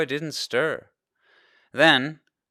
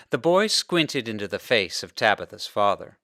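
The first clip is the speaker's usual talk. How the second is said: plain reading voice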